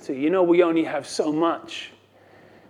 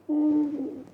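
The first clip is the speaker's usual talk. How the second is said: weary hum; 10 semitones higher, 7 dB quieter than usual. Retold or imitated. Third time, weary groan.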